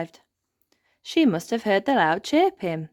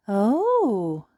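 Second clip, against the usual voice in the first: a higher, breathy oh of wonder; excited gasp; 4 semitones higher than usual. Acted. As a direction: gasp it out with delight. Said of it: "gasp Ben and Holly excitedly".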